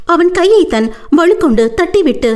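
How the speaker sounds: ordinary delivery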